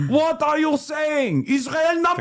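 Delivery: put-on very high, drawn-out Israeli accent; hammed-up outrage in an Israeli accent; mock Israeli accent. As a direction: squeal it as an indignant Israeli commenter. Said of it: "pretty good Israeli accent".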